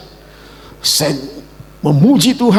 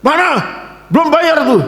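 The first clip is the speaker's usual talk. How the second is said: pushy debt collector; 11 semitones higher than usual. Retold or imitated. Debt collector at the pastor's door, demanding payment. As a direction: hammer on the door and demand the money. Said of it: "Ngetok pintu tuh kerasnya minta ampun".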